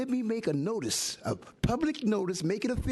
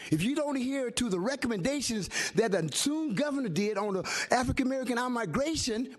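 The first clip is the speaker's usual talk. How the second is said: normal talking voice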